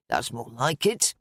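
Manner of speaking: low, slow man's voice; contented man. A narrator's reading. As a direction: settle low into quiet satisfaction. Derek relaxes over ice cream.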